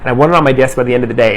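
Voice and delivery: plain reading voice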